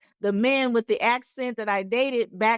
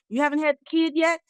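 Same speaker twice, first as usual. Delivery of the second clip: naysayers nagging; 6 semitones higher, faster than usual. Retold or imitated. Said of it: "people try to tell you".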